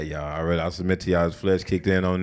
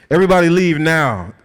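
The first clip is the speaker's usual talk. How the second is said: commanding order; 8 semitones higher, 7 dB louder than usual. Acted. Joseph orders everyone out.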